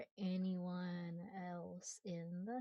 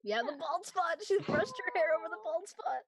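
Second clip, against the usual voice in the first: laughing; 9 semitones higher, 8 dB louder than usual. Real, not acted. Laughing admits bald spot.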